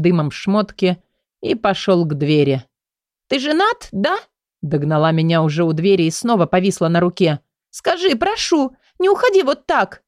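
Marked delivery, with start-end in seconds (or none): 3.3-4.2 put-on hurt girl. A narrator's reading. Girl asks if he is married. 7.7-10.0 desperate plea. A narrator's reading. Girl begs at the door.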